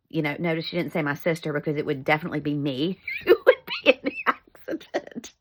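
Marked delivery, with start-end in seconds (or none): 3.1-5.3 words breaking up into laughter; real laugh running through the words. Real, not acted. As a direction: crack up mid-sentence at your own joke. Laughs at her own joke.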